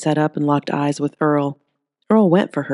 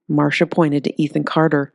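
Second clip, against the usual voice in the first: plain reading voice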